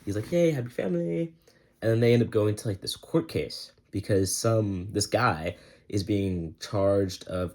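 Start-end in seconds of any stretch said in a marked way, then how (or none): 0.3-1.3 high bright hey; hammed-up happy dad; mock cheer. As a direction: sing out a sunny greeting. Father cheerfully reunited.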